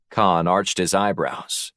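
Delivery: usual speaking voice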